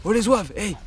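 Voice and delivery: loud, high call to the dogs. Real, not acted. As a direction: raise the voice to stop them. Dogs start scuffling.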